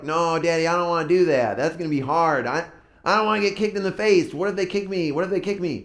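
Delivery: mock a child pleading to get out of it; worried kid whine, played up; whining kids. Kids' reluctant protest retold.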